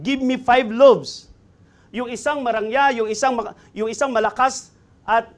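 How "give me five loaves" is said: slow, loud, drawn-out begging; loud plea. Acted. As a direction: press the hungry request hard. David begging the priest for bread.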